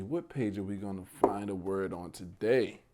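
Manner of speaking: usual speaking voice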